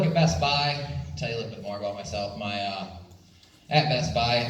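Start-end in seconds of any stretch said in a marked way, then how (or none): none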